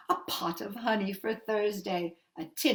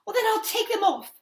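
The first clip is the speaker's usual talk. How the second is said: high, loud and eager; eager offer; 11 semitones higher, 5 dB louder than usual. Acted. Mother offering the witch to take her shoes off, eagerly. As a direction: rush to agree.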